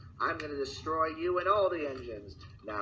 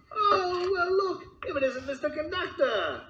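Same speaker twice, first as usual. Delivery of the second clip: much higher, gushing lady voice; wife greeting the conductor in a high voice; wife's high voice; 14 semitones higher than usual. Acted. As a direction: greet him brightly as the lady.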